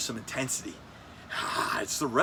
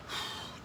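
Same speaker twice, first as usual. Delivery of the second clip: long breathy exhale; sigh; 12 dB quieter than usual. Real, not acted. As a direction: let out a calming sigh. Sighs before calming himself.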